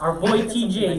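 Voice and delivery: hype announcer. Acted. Hyping up guest introduction.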